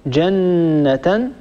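جنة said very slowly, with the doubled n held; slow demo. Shown as a demonstration. Teacher slowly demonstrates جنة. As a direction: stretch جنة slowly, leaning on the nn. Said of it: "أيضًا".

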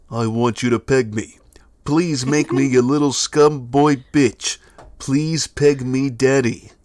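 gruff action-hero growl, slow and heavy; Duke Nukem voice. Pretend. Reading a donor's dare as Duke Nukem, slow gruff voice. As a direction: deliver it like a cocky action hero, dragging each line. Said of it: "do the Duke Nukem voice".

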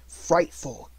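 ordinary delivery, nothing marked

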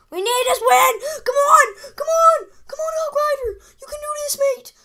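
very high, loud pleading cheer; will the card through with everything; desperate cheering. Real, not acted. Gamer begging his Hog Rider for the win, high and loud.

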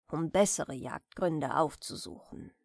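usual speaking voice

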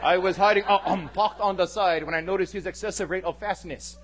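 mock-formal Hawaiian local testifying; stilted courtroom English with a Hawaiian local accent; local attempting perfect English. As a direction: imitate a local straining for proper courtroom English. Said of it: "local folk who are trying to talk, you know, perfect English in court".

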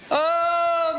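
usual speaking voice

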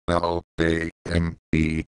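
mock spelling. Spelling out lame mockingly.

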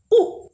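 उ said short and high. Shown as a demonstration. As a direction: clip उ short. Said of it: "छोटी आवाज में बोला ना मैम ने". (छोटी आवाज में उ)

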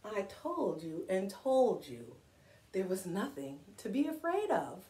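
soft Grover aside. Acted. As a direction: murmur it like a sheepish monster. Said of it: "you know what Grover says? Listen".

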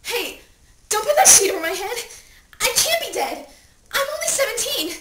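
loud, high-pitched panic; frantic protest. Acted. Dead teen begging not to be covered, frantic and loud. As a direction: cry out in desperate denial.

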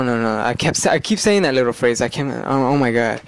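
usual speaking voice

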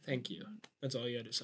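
very soft, low and curt; quiet. Real, not acted. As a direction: say it under your breath. Pants argument.

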